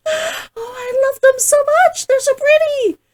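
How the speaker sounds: high, loud gushing; gushing delight. Real, not acted. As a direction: coo over them, thrilled to bits. Gushing over her new ears.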